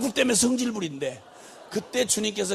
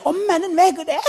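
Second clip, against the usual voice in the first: put-on lightly higher toddler voice; a hint of a child whining at mom; three-year-old; 9 semitones higher, 5 dB louder than usual. Toddler complaining to mom, lightly voiced. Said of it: "세 살짜리".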